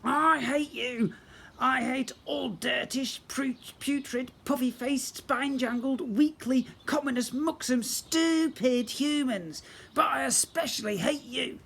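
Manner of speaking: high, slow, spat-out tirade of hate; a spoilt prince raging at the boy; raging goblin prince. Acted.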